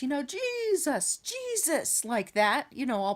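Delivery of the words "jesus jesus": high, drawn-out televangelist cry. Retold or imitated. Dreamer chasing the demon off the yard, televangelist style. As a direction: call it out like an all-powerful TV preacher. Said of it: "I'm saying it like the televangelist".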